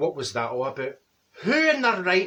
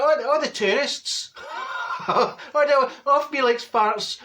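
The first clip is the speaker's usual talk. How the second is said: laughing; 7 semitones higher, slower than usual. Real, not acted. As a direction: crack up mid-sentence.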